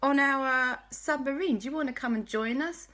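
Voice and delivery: high, bright invitation to a party; party friends calling from a submarine; excited friends. Acted. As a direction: call out gleefully to the bunny. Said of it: "Quasi Cat and Dashy said".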